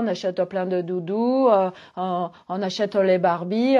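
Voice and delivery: normal talking voice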